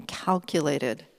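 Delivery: ordinary talk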